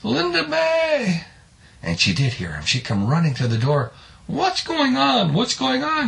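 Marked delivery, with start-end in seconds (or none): 0.0-1.2 high, slow drawn-out call; stretched high-pitched acted yell; hollering. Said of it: "he's hollering". 4.3-6.1 high, drawn-out worried cry; high-pitched acted fretting woman; alarmed wife. Wife runs to door, alarmed.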